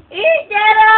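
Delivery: high, ringing wake-up call. Real, not acted. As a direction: holler to wake someone up. Calling out to wake Ichiro.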